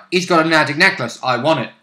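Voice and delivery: ordinary delivery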